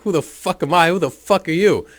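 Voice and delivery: high, loud, throwing the question back; retold furious yell. Angry father shouting back at Schmokes, loud high yell. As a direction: roar it right back at him.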